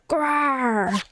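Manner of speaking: rising, put-on tiger roar. Tiger closing in on the pair, put-on roar. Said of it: "I do a great impersonation of a tiger".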